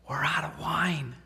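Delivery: slow, breathless whispered confession. Retold or imitated. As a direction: whisper the bad news slowly. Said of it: "in hushed voices".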